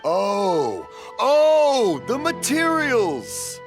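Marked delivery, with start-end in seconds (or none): 1.2-3.6 it finally clicks, delighted to catch on; sudden realization. Acted. Father finally understands.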